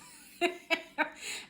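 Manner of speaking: high loud burst of laughter. Real, not acted. Vlogger laughing at her naive past, loud laugh.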